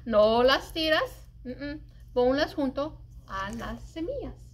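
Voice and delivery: ordinary delivery, nothing marked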